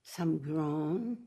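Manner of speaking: ordinary talk, nothing marked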